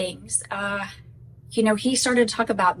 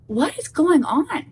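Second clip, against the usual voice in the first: bewildered; 7 semitones higher, slower than usual. Retold or imitated. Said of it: "I looked at him like".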